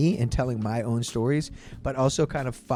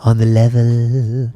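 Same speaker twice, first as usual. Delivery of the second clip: [name] impression; 4 semitones lower, 8 dB louder than usual. Mocking how [name] talks, low and drawled. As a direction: sound like a stoned laid-back singer. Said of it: "That's how he talks".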